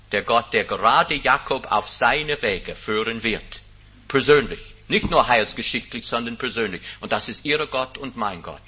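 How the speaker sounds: ordinary talk, nothing marked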